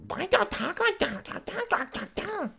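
very high, very slow mock voice; mocking a squeaky voice. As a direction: parody a voice he finds annoying. Mocking an actress's voice.

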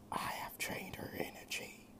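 soft, breathless whispering demon; demon king whisper. A narrator's reading. Demon king whispering inside Drake's head, menacing whisper. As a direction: hiss it like a voice inside his head.

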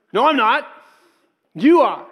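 loud, high defensive comeback; mock heated retort. Husband to wife Tasha, loud defensive retort. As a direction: fire it back at her.

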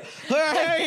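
sudden high laugh; high burst of laughter. Real, not acted. Guest cracking up at host's joke, high laugh.

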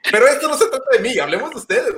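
laughing while talking fast. Real, not acted. Laughs at himself mid-anecdote. (riéndose mientras habla)

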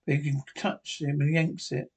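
ordinary delivery, nothing marked

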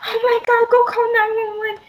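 high, frantic mock scream of a panicking mom; imitating mom screaming. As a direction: shriek it like a frightened mother. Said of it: "She was screaming".

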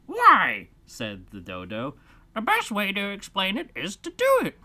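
dodo voice. Acted.

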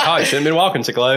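imitating dad, chuckling through it; mock-scold while laughing; dad's teasing excuse, laughed out; laughing dad quote. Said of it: "and he'd laugh about it".

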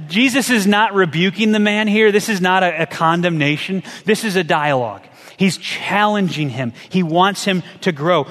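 ordinary talk

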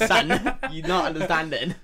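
high and loud, laughing through it; laughing disbelief. Retold or imitated. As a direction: laugh while telling her off. Exasperated reply to drug request.

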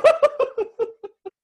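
high, loud peal of laughter; real laugh. Real, not acted. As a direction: burst out laughing at your own bad luck.

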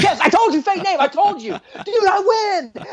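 high-pitched gloating cheer; celebrating. Retold or imitated. Cop rubbing the win in to the driver, celebrating. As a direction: crow over the win, stretching the end. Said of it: "dancing, like, celebrating".

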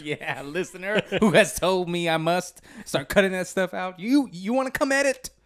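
high, loud mock scolding; mock anger. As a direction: pretend to challenge a nagging listener. Mock-scolding the listener, then just playing.

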